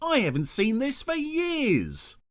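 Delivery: excited. Acted. Roger excited at old programme.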